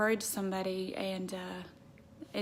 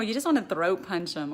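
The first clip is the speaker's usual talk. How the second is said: ordinary talk, nothing marked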